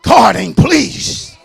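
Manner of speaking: slow, loud preaching cry; preaching shout. Real, not acted. Preacher crying out to the church, slow loud shout. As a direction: cry it out to the congregation slowly.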